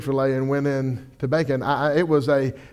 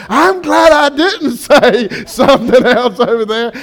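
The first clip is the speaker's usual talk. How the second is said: relieved laugh; 8 semitones higher, 8 dB louder, slower than usual. Retold or imitated. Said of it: "they were like".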